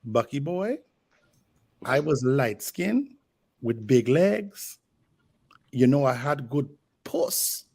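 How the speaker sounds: lightly voiced Caribbean grandmother, slow; a hint of a Caribbean granny, drawn out slowly; Caribbean grandma. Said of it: "my family's Caribbean, my grandmother would go".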